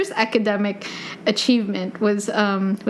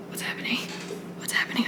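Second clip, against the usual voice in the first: hushed, anxious whisper, said twice; nervous whisper; 10 dB quieter, much faster than usual. Retold or imitated. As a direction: whisper in panic when your name is called. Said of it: "And I was just like".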